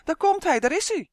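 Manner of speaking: high, loud shriek of alarm; panicked scream. A narrator's reading. Fleeing fairies warning everyone, screaming. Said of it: "schreeuwden ze".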